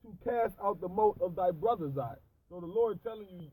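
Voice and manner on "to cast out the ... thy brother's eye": slow, loud solemn recital; scripture reading. Acted. Reading the verse to a friend, slow and loud. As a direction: lean on every word of the verse. Reads scripture aloud again.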